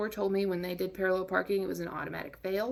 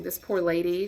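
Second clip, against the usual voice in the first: usual speaking voice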